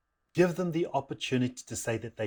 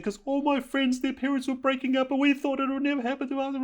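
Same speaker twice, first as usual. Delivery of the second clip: high-pitched mock wail; hammed-up squeaky crying voice; tearful daughter; 14 semitones higher than usual. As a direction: imitate a child bursting into tears. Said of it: "just burst into tears going".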